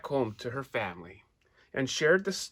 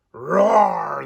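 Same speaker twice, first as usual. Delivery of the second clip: loud, higher roar full of joy; happy lion roar; 5 semitones higher, 12 dB louder than usual. A narrator's reading. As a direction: roar out in relief and happiness. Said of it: "yelled out again, this time with happiness".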